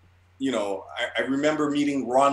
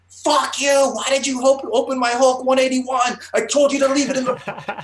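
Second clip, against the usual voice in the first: shrill-pitched furious mock scream; top-of-lungs screaming; 6 dB louder than usual. Slamming his friend against the glass, mock-furious screaming. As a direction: scream it full out in a staged fight. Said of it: "started screaming at the top of my lungs".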